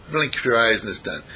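normal talking voice

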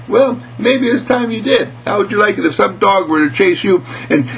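high-pitched little mouse retort; scolding mouse. Acted. Mouse challenges the cat about dogs, high and scolding. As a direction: lecture as a small, plucky mouse.

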